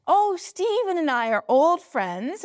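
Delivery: a hint of an eminent British scientist, slowed down; quoting Martin Rees. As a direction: lightly voice the senior astronomer, no accent attempted. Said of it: "I'm not gonna do a British accent because I can't".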